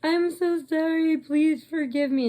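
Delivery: high, whiny mock apology; imitated high-pitched pleading; pleading blonde girl. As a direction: beg forgiveness as the guilty friend. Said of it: "the blonde girl's like".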